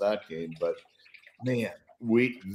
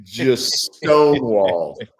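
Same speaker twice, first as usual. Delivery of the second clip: each word stretched and louder; slow, awed stonewalled; slow emphatic awe; 6 dB louder, slower than usual. Real, not acted. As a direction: savour the big stop, slowly. Excited recall of the stop.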